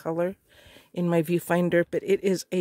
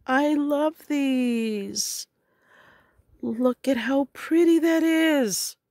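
excited; 6 semitones higher, slower than usual. Real, not acted. Admiring the crochet leaves.

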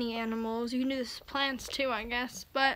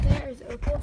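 ordinary talk

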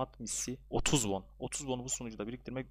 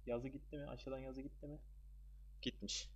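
very soft, tense murmur; hushed suspense; 2 semitones higher, 11 dB quieter than usual. Real, not acted. Gamer afraid to look at whether the upgrade text vanished, hushed. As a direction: keep it down, dreading the result. Not looking, anxiously awaiting result.

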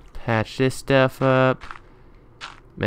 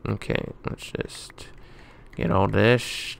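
ordinary delivery

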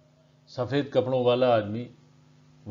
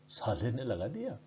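softly said aside; quiet, suppressed amusement; 8 dB quieter than usual. A narrator's reading. Policeman privately amused at the slap, quiet aside. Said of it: "धीरे से बलदेव ने कहा".

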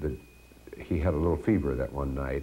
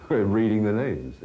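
laughing; 6 semitones higher, 4 dB louder, slower than usual. Real, not acted.

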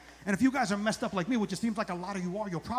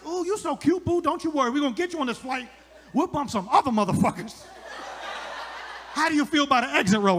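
higher-pitched, sassy imitation of a woman; gushing gate agent; 6 semitones higher, 4 dB louder than usual. Atlanta gate agent to nervous traveler, gushing imitation. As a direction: coo reassurance, building to a louder offer.

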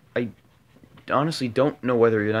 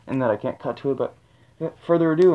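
ordinary talk, nothing marked

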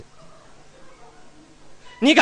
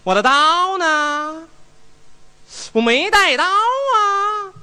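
panicked eunuch; 6 semitones higher, much slower than usual. Acted. Eunuch finds no knife.

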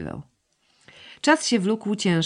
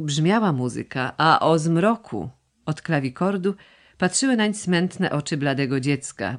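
ordinary delivery

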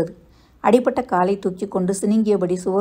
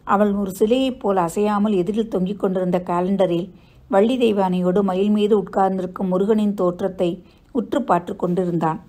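ordinary delivery

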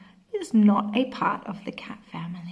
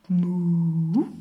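loud mooing cow; 2 semitones lower, 6 dB louder than usual. Acted. Teacher reviewing farm sounds with a loud moo. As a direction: give the cow's moo.

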